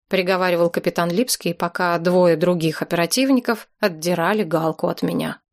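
ordinary delivery

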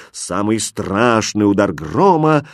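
ordinary delivery

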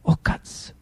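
breathless whispered oath; a terrified priest whispering; whispered curse. Acted. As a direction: whisper the shock under your breath. Archbishop's frightened gasp.

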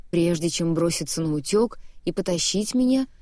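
plain reading voice

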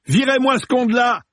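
high-pitched angry holler, volume held back; contained yell. A narrator's reading. Film director yelling at crew about the intruder, angry holler. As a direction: call it out furiously from behind. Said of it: "entendit le réalisateur gueuler derrière lui".

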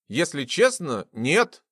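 ordinary delivery, nothing marked

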